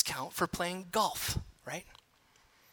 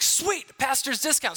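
high, thrilled little cheer; sudden gleeful high pitch; delighted; 7 semitones higher than usual. Retold or imitated. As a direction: squeal it like a happy bargain hunter. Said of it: "my dad's like".

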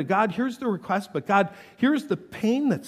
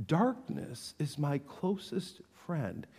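quiet repeat; 2 semitones lower, 10 dB quieter, slower than usual. Acted. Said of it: "He didn't qualify this a bit".

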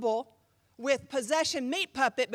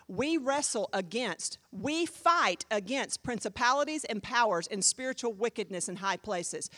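ordinary talk

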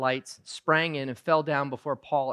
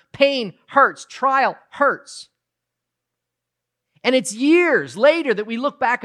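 higher, louder, impassioned sermon voice; full-force preaching; 8 semitones higher, 5 dB louder than usual. Acted. Preacher urging the congregation about suffering, impassioned preaching. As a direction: drive the point home to the room.

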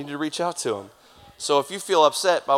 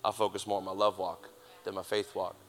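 softer, lower, reverent; quiet, reverent quote; 4 semitones lower, 8 dB quieter than usual. Retold or imitated. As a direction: say it softly, with reverence. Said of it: "the faith man said".

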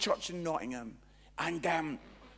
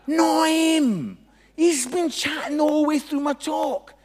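high, shouted objection; indignant protest; 10 semitones higher, 14 dB louder than usual. Retold or imitated. Speaker protests to God about the chatty guy, indignant shout. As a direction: protest loudly, pitch shooting up.

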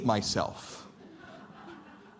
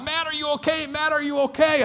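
worried coaches calling; 12 semitones higher than usual. Retold or imitated.

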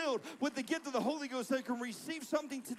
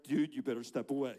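low, louder mock warning; wary warning; 10 semitones lower, 5 dB louder than usual. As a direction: warn someone off in a low voice. Voices wary child looking at him.

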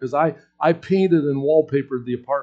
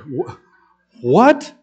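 shocked what; 5 dB louder than usual. Retold or imitated. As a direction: jump in alarm at the word. Hearing seminary as cemetery.

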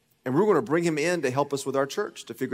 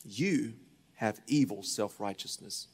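soft voice of God; 3 semitones lower, 6 dB quieter than usual. Retold or imitated. The Lord confronting Kevin, hushed and solemn. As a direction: let God speak gently but firmly. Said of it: "The Lord said".

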